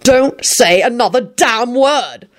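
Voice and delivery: high, slow, emphatic order; wild shouting. A narrator's reading. Quentin silencing two women, frantic command. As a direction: hammer out each word, exasperated. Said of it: "Quentin shouted wildly".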